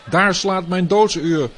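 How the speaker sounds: high, loud panicked cry; terrified scream. Acted. Tyrant wakes to the news, loud panicked shout. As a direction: shriek it out like doom has come. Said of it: "schreeuwde hij".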